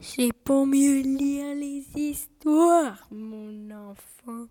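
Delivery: lent, doux, voix de fausse grand-mère; mielleux grand-mère loup. Acted. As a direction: endormir la victime avec douceur, lentement. (le loup, déguisé en grand-mère, répond à Lapin d'une voix lente et doucereuse)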